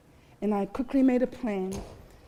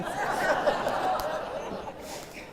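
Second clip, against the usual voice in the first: airy unvoiced giggle; 7 semitones higher, 4 dB quieter than usual. Real, not acted. Laugh at louver joke.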